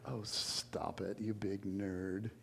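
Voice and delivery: soft, slow, coy mock flirting; flirty teasing. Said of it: "can flirt with a guy".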